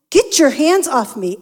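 loud, high, snapping at her husband; angry outburst. Retold or imitated. Wife in bed snapping at her husband, loud and angry. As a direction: shove him off with the words.

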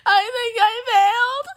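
high, squeaky tearful wail; mock blubbering. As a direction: confess it through hammed-up sobs. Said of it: "fucking blubbering in the car".

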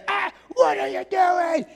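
high startled yell at the idol worshippers; outraged leader yelling at his people; mock angry shout. Moses yells at people.

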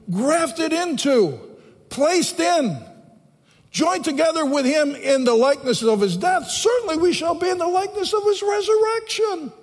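high, ringing sermon delivery; fervent preaching. Real, not acted. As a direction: proclaim it to the whole congregation. Preaches at full force.

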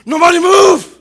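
hammed-up father bellowing a command; the girlfriend's dad shouting at the tire light; mock panicked dad shout.

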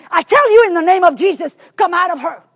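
loud, fast, commanding shout; forceful loud command. Retold or imitated. Preacher shouting at the demon in frustration, loud. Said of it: "my voice got louder and louder".

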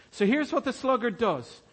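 ordinary delivery

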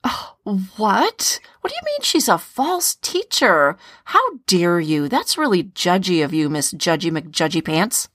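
hammed-up offended church friend; mock indignation. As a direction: play shocked and affronted, pitch leaping up. Acted offended reply in role play.

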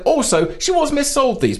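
high, smug mock triumph; triumphant. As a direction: play the trump card with relish. Playing the trump card.

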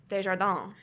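Desjardins as day-zhar-DAN; correct French pronunciation. Shown as a demonstration. Confirming the Google result, Desjardins as French day-zhar-dan. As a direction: say Desjardins with a French nasal ending. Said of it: "I was affirmed".

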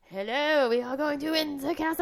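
high, loud mock French accent; drawn-out, hammed-up bad French accent; Ewan McGregor's French accent. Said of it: "any of the parts where he was speaking".

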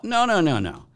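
ordinary talk

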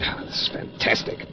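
whispered disbelief; awed whisper. Acted. Assistant awed by the reanimation experiment, whispered. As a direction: breathe it out in wonder.

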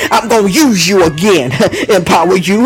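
driving charismatic sermon with gasped breaks; fervent preacher breaking into tongues; full-force preaching. Real, not acted. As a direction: build to an impassioned, punched refrain.

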